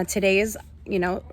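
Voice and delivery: ordinary delivery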